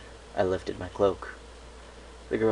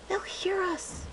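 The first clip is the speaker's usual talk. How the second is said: slow, high filly voice hissing the warning; a little filly voice, urgent and hushed; hissed child warning; much slower than usual. Acted. Filly warns the others from the bushes, high hushed hiss. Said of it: "another hissed".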